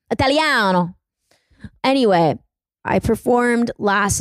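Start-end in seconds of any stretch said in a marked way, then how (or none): 0.1-0.9 hammed-up Italian flourish, higher pitched; mock Italian. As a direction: play up a true Italian. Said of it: "how to be a true Italian".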